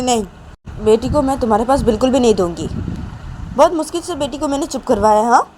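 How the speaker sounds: loud, high, emphatic scolding; stern refusal. A narrator's reading. Manisha refusing crying Nisha the baby, scolding. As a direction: refuse firmly, telling her off.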